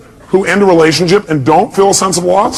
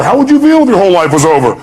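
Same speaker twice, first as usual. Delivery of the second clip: ordinary delivery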